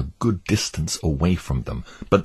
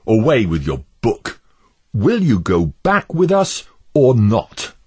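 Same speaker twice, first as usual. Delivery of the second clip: impatient Obstinate; 5 semitones higher, 5 dB louder than usual. Acted. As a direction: press him for a yes or no. Obstinate demands an answer.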